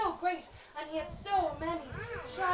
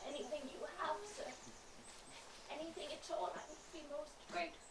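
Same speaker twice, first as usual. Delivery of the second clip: much softer, thin and pleading; weak voice; 14 dB quieter, faster than usual. Acted. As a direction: beg in a faint, thin voice. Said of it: "as thin as his weak voice".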